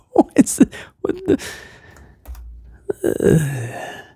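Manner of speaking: voiceless, dumbfounded mock whisper; baffled whisper. Wrestler reacting to a stupid question, baffled whisper. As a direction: whisper it in broken-off disbelief.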